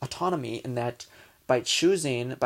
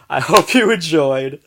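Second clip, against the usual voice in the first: laughing; 2 semitones higher, 10 dB louder than usual. Real, not acted. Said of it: "I'm having a good time here".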